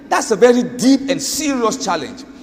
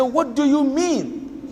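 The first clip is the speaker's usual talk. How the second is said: slow, higher-pitched, insistent retold question; pressing question; 5 semitones higher, 4 dB quieter, slower than usual. Pressing her after repeated answer.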